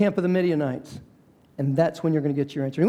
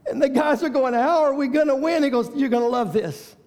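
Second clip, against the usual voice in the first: rising to full preaching force; climactic preaching; 7 semitones higher than usual. Acted. Preacher building the congregation to Gideon's victory cry.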